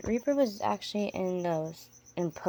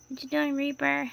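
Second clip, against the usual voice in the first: higher, fond voice to a pet spider; pet talk; 7 semitones higher, 4 dB louder than usual. Real, not acted. Owner greeting her tarantula Reaper, fond and higher.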